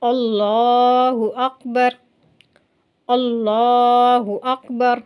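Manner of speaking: अल्लाहु with a full, heavy dark ल, drawn out slowly; dark lam recitation; heavy lam in Allah. Shown as a demonstration. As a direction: recite अल्लाहु अकबर with a thick lam, slow tajweed. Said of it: "मेरे साथ पढ़िए".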